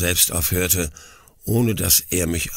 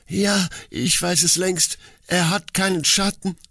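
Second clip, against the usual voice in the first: high-voiced young woman, despairing; Mina in despair; 10 semitones higher than usual. A narrator's reading. Mina confesses her knowledge before her father, despairing. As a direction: voice her grief high and trembling. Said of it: "rief Mina aus".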